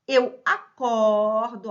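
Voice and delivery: drawn-out loud "acordo" with an open O, "a-CÓR-do". Shown as a demonstration. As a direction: stretch the O of "acordo" wide open, slowly. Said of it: "ó, aberto".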